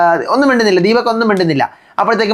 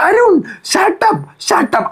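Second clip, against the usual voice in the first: much higher, very slow, drawn-out "Arun, shut up"; stretched mock outburst; 10 semitones higher, much slower than usual. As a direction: stretch the name out high and slow, then snap shut up. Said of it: "ഇവിടെ നിന്ന് ഇങ്ങനെ എത്തിയിട്ട്". (അരുണിനോട് നീട്ടി വലിച്ച് ഉയർന്ന ശബ്ദത്തിൽ shut up)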